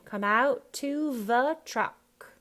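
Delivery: "the" as va, slow and loud; Cockney th-as-v. Shown as a demonstration. Coach modelling the line, Cockney th-as-v. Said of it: "with a V on the".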